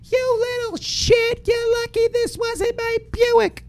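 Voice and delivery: high shrill-free mock old lady, slow and scolding; neighbor's wife scolding the teen driver, high mock voice; angry wife. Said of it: "and she's like".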